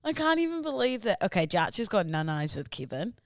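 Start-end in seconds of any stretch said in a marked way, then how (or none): 0.0-1.2 higher-pitched, drawn-out gratitude; gushing thanks. Real, not acted. Host thanking a listener for the care package, gushing. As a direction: stretch it out, touched and delighted.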